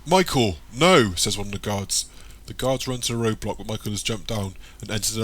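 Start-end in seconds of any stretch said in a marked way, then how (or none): 0.1-1.1 loud, high warning; calling out. A narrator's reading. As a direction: call out to stop him. Guard calling out.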